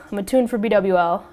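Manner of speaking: ordinary delivery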